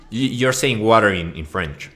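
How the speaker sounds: usual speaking voice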